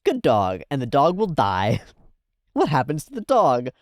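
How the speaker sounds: ordinary talk, nothing marked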